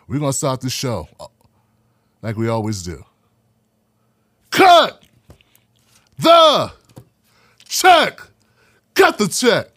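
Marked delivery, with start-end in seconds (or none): 4.5-8.2 loud, high, with a long pause after every word; catchphrase shout. Real, not acted. Host opens the show with his catchphrase, shouted word by word. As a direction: punch each word separately, high and loud.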